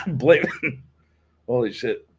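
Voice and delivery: strained words between coughs; coughing from vape. Real, not acted. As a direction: talk through a choking cough. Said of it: "that vape went down the wrong pipe".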